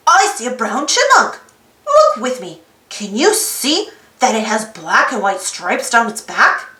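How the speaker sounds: plain reading voice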